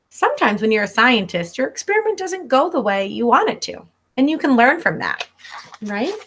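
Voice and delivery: ordinary delivery, nothing marked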